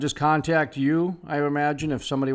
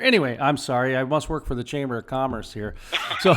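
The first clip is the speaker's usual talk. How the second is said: usual speaking voice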